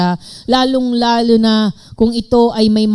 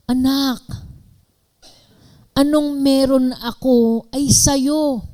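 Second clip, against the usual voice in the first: tender father; 6 semitones higher, slower than usual. Pretend. Father answers older son.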